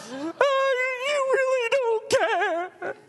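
very high, drawn-out fake sobbing; mock wailing. Mary answering a brusque Jesus, mock wailing reproach. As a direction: bawl it in hammed-up hurt.

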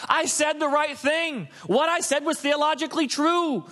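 high-pitched mock righteousness; self-justifying insistence. Someone justifying a response made in the moment, self-righteous insistence. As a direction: insist on being right, hammed-up. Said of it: "we justify it by saying".

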